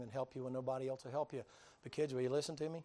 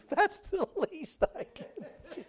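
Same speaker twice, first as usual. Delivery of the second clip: much higher, laughing through the words; laughing gush; 8 dB louder than usual. Retold or imitated. Rescued woman laughing as she agrees to pay. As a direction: bubble over with laughter. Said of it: "she said".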